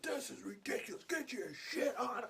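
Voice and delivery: put-on high, strained old-man complaint; imitated griping. Old guy griping while the customer pays, mock exasperated holler. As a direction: gripe it high, dragging out the end. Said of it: "he's like".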